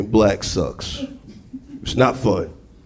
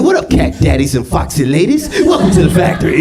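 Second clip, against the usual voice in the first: jive Oompa Loompa; 4 semitones higher than usual. Acted. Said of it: "Oompa Loompas talking in jive".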